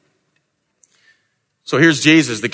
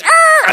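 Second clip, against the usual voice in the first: very high, loud sharp "ah"; a jolting cry that marks a sudden turn; a high yelped ah like a screech of brakes; 4 dB louder than usual. Acted. Sound effect of Peter's U-turn.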